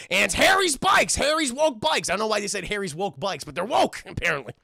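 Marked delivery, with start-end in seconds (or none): 0.1-2.1 loud, high mock ad shout; infomercial pitch. TV switches back to the commercial, infomercial shout. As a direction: belt it out like a TV commercial. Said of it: "infomercial, chat, commercial".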